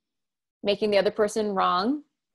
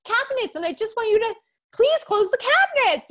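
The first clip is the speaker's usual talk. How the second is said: pitched-up exasperated plea to husband; mock pleading wife; 12 semitones higher than usual. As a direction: wail at him to close the cabinets. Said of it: "And I'm like".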